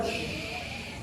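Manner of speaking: hushing someone with a drawn-out shh; long shush. Retold or imitated. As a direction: imitate annoyed viewers shushing. Viewers shush him.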